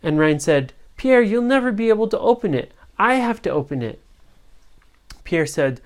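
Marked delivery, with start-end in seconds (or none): 1.0-4.0 fast taunt. Acted. Ryan taunts pirate.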